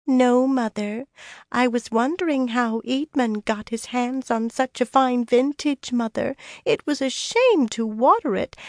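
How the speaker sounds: high-pitched, syrupy mock-dutiful daughter; sickly sweet. A narrator's reading. Elaine humours her coddling friend as Mother, sickly sweet. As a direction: sugar every word, pitched well up. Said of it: "in a sickly sweet voice".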